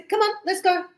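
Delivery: high, pushy; urging. Shown as a demonstration. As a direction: hurry them along. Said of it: "if I say".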